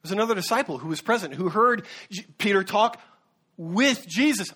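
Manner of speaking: ordinary talk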